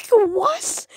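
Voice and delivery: high, loud mock-startled "what"; startled retort. Teen caught fangirling by dad in the car, startled retort. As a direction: blurt it out, caught off guard.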